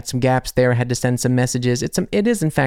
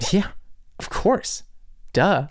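put-on bubbly sorority girl; 6 semitones higher, 3 dB quieter, slower than usual. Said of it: "German friends speak German with a Valley Girl accent".

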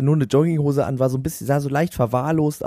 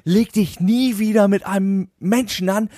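mock rant of the drunk guy; 9 semitones higher than usual. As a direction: ham up a boastful drunk's tirade. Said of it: "den ikonischen Satz gesagt".

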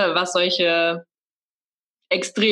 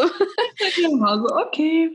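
laughing; 9 semitones higher, much slower than usual. Real, not acted. Laughs at the pregnancy story.

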